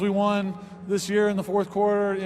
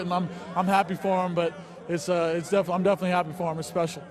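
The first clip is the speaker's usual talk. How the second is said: ordinary talk, nothing marked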